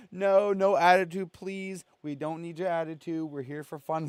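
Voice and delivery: high, pleading and begging; pleading with the ghost. Retold or imitated. Imitating Corey pleading with the angry ghost, high and begging. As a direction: beg the spirit in a high voice. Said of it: "Throw him through the wall?".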